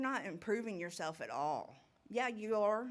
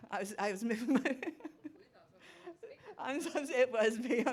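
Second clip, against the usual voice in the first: laughing through the words, a bit louder; real nervous giggles breaking in; laughing embarrassed; 6 semitones higher, 5 dB louder than usual. Real, not acted. Embarrassed by noise she made.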